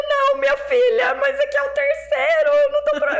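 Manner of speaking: high, loud exaggerated wail; mock crying. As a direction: wail the words as if bawling to a child. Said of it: "você chorava?". (imitando choro, voz bem aguda)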